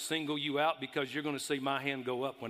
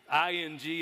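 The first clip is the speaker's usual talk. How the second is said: normal talking voice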